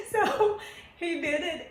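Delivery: laughing through the words, slowly; amused, still laughing at herself. Real, not acted. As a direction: let the laugh spill into it. Laughs at the memory.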